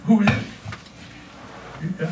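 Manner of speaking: slow, breathy near-whisper; acted hushed wonder; hushed retold reaction. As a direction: draw it out softly, barely voiced. Retells reaction to God's voice.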